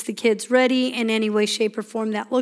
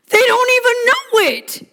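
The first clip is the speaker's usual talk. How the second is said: much higher, louder amused squeak; 11 semitones higher, 4 dB louder than usual. Real, not acted. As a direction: pitch it way up in amusement. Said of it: "the funny thing is".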